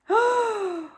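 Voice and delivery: loud high gasp of surprise; big gasp. Acted. Teacher gasping at the robbers' van, mock alarm.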